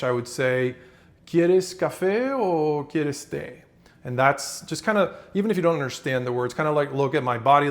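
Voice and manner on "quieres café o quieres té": slow, lilting Spanish "¿Quieres café o quieres té?"; Spanish phrase, wavy. Shown as a demonstration. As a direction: let "¿Quieres café o quieres té?" roll slowly and melodically. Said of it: "if I were to say this in Spanish".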